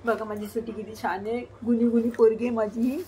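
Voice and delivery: ordinary talk, nothing marked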